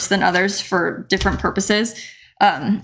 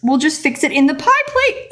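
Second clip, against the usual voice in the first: ordinary talk, nothing marked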